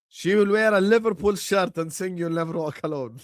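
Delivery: ordinary talk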